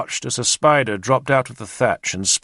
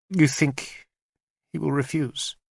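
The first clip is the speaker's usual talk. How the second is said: a small, timid, higher question; small frightened voice; 5 semitones higher, 4 dB quieter than usual. A narrator's reading. Queen fears the king's answer, small frightened voice. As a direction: ask fearfully, voice shrinking. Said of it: "in a small, frightened voice".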